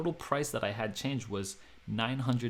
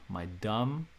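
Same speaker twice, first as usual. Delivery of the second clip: usual speaking voice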